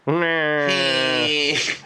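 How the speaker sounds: long, high nasal whine; loud drawn-out honk through the nose; nasal demo noise. Shown as a demonstration. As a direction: demonstrate a nasal Aussie vowel, held long. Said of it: "out of anywhere except for your nose".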